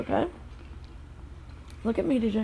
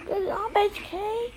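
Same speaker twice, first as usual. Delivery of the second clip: high cheering praise; 11 semitones higher, slower than usual. Real, not acted. Cheering son in snow.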